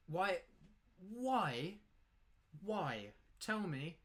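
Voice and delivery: high, insistent, drawn-out questioning; exasperated demand. Real, not acted. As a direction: plead for an explanation, pitched high. Exasperated challenge to opponent's play.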